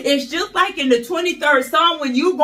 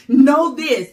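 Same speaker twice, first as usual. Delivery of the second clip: ordinary talk, nothing marked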